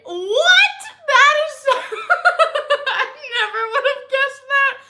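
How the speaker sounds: real high-pitched, loud astonished laughter; laughing disbelief. Real, not acted. Vlogger floored by the cookie brand name, laughing incredulously. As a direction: shriek "what" and crack up.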